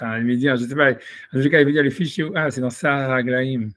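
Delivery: ordinary delivery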